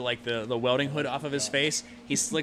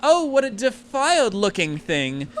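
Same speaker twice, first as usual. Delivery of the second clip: much higher, louder appalled gasp; posh mum recoiling in disgust; disgusted mother; 9 semitones higher, 6 dB louder than usual. Acted.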